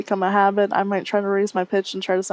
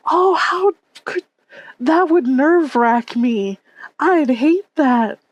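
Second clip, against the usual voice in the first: whimpering sympathy; 6 semitones higher, 3 dB louder, much slower than usual. Real, not acted. Sympathy for airport announcer.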